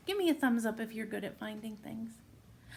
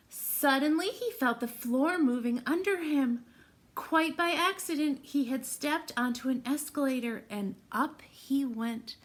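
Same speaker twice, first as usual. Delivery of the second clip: louder, suspenseful read-aloud; excited storytime narration; 4 semitones higher, 4 dB louder than usual. Acted. Teacher reading the escalator surprise to the class, excited. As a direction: build the surprise for the kids. Reads picture book to children.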